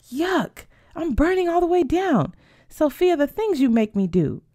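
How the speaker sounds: high-pitched, revolted wail; disgusted outcry. Acted. Berta blames friend after a shot, disgusted outcry. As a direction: complain high as it burns down.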